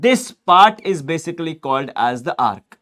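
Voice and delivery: words spaced out slowly; slow dictation. Shown as a demonstration. Teacher dictating the arc definition to students, slowly.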